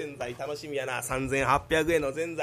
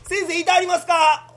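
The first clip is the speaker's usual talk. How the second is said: higher, louder call into the room; 11 semitones higher, 12 dB louder than usual. Acted. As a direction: call for the doctor through the door. Calling into the clinic.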